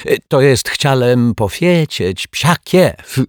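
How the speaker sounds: high, slowed foreign-guest voice; high and loud Turk character voice; flustered Turk. Acted. Fallen Turk corrects his curse.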